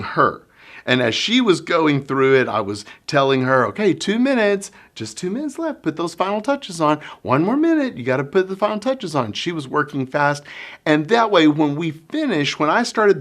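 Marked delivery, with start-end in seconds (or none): 3.7-9.3 bright, higher coaxing teacher; encouraging countdown. Retold or imitated. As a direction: nudge her gently toward finishing. Teacher coaching child to finish.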